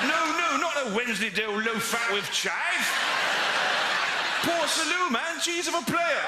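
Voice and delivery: high, loud street-slang shout; big up the cheese like a hype man; excited street slang. Retold or imitated. Young West Londoner ordering cheese at a deli, loud and high.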